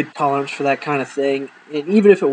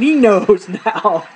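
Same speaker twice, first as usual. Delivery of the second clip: laughing slowly; 3 semitones higher, much slower than usual. Retold or imitated. Sister giggling.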